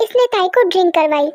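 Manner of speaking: ordinary talk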